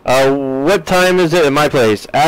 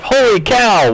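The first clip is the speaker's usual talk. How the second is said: surprised; 8 semitones higher, slower than usual. Real, not acted. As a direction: blurt it out in real surprise. Streamer reacts to chat name.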